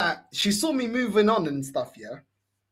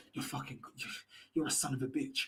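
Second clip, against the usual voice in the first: much softer, almost breathed; hushed mock insult; 8 semitones lower, 16 dB quieter than usual. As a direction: drop to a near hush for the insult. Retells her sudden insults.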